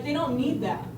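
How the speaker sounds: usual speaking voice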